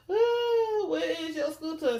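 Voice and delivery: high, drawn-out mock wail; teasing imitation. Narrator relives the catchphrase after laughing, teasing imitation.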